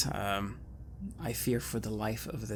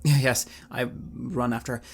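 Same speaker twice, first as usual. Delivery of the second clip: normal talking voice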